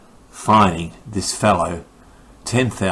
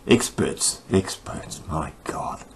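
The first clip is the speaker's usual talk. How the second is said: exasperated whisper; 3 semitones lower, 7 dB quieter, slower than usual. Real, not acted. Exasperated aside reading the article.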